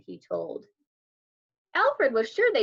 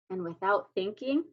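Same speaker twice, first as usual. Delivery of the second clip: usual speaking voice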